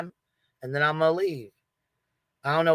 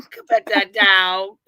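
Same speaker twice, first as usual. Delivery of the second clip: higher, louder, slow mock-sweet plea; mock sweet ask; 4 semitones higher, 5 dB louder, slower than usual. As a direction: belt it out sweetly as a gag. Repeats the joke line.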